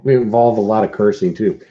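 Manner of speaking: ordinary talk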